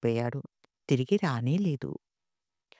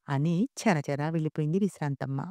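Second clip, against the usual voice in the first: ordinary delivery, nothing marked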